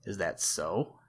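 ordinary delivery

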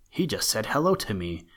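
usual speaking voice

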